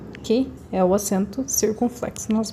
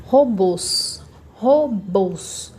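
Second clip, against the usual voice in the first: robôs slowly split into RO and BÔS; syllable by syllable; much slower than usual. Shown as a demonstration. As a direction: sound out robôs, drawing out BÔS. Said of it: "A palavra que eu falo mais forte".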